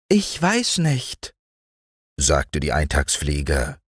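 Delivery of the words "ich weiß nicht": high, slow, airy mayfly voice; dreamy mayfly. A narrator's reading. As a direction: drift the words like a carefree mayfly.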